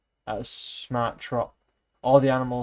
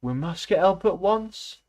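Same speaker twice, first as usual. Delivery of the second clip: higher, urgent and slow; urgent plea; 8 semitones higher, 5 dB louder, slower than usual. A narrator's reading. Clover begging the animals to fetch help, urgent and higher. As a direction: plead for help, pressing hard. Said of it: "said Clover".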